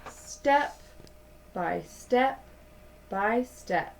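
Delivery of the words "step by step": plain reading voice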